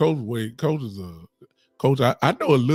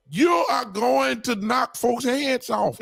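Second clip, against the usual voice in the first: fired-up shout; 8 semitones higher, slower than usual. Real, not acted. Fired-up pundit hyping the formation.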